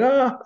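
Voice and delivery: high-pitched imitation of helpless laughter; mimicked laughing cry. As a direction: mimic someone laughing their head off. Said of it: "He's laughing his head off, going".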